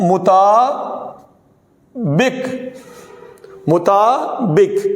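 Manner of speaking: مطابق drawn out very slowly, twice; teacher slowly modelling مطابق; slow correct pronunciation. Shown as a demonstration. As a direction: spell مطابق with the voice. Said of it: "پھر دیکھیے گا".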